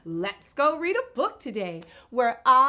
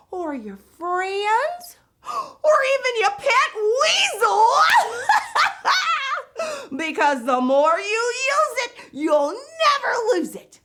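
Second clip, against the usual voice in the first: much higher, louder, giddy host voice with laughs; bubbly Miss Vocab; 11 semitones higher, 6 dB louder than usual. Acted. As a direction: play the over-the-top vocab host. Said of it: "I'm Miss Vocab".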